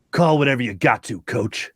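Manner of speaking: put-on low, subdued voice quoting the player; imitated player voice. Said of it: "Did he say in that voice, ... just like that".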